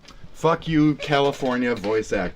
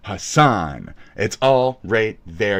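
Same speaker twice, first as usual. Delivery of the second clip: ordinary delivery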